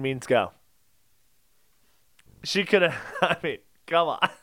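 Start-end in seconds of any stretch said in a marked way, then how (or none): none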